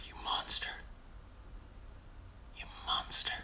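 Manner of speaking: voiceless teasing whisper; whispered mock shame; mock accusation. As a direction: hiss it at yourself in fake horror. Mock scolding himself.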